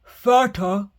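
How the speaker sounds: loud eager troll shout; loud drooling troll questions. Acted. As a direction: shout greedily. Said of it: "he shouted".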